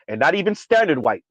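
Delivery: ordinary talk, nothing marked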